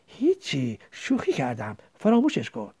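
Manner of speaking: faraway, dropping the subject; absent-minded. A narrator's reading. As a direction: brush it off with your mind elsewhere. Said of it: "انگار حواسش جای دیگر است".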